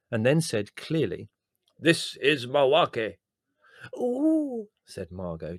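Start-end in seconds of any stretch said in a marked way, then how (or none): 1.8-3.2 put-on a slow, high Indian accent for the spirit guide. Medium channelling her spirit guide Mawake in a slow Indian accent. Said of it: "strong Indian accent". 4.0-4.7 a high, thrilled "Ooh"; delighted. Acted. Margot thrilled as the spirit arrives, a high delighted coo.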